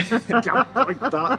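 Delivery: Scouse accent. Shown as a demonstration. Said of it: "frontier land between Lancashire and Scouse".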